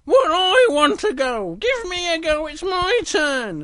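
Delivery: high, loud, stretched-out demand; Little Bear voice. Acted. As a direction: whine for a turn like a cub. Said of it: "And Little Bear goes".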